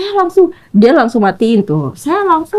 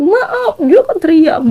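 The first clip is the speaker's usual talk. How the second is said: ordinary talk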